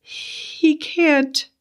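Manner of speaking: high, tearful woman's voice; slow, high and upset; weeping. A narrator's reading. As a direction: plead in a thin high weep. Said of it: "Betty wept".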